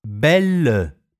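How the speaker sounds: "Belle" with an open è. Shown as a demonstration. Teacher modelling an open e, clearly. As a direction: let the e in "Belle" open wide. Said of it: "Si pronuncia aperta".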